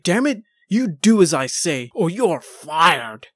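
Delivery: mock angry boss yell, drawn out at the end; Vince screaming. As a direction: play at bawling out an employee over a headset. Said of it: "Vince screaming down the headset".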